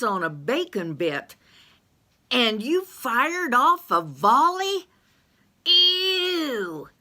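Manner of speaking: high, loud squawk of disgust; a high-voiced yokel recoiling from a stink; high pitched, disgusted and loud; disgusted Carl. Carl's voice, disgusted.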